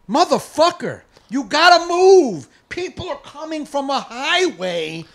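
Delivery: high, loud and slow; yelling at a stalled driver from the car; furious yell. Retold or imitated. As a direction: shout at the guy blocking the way. Yelling at parked guy.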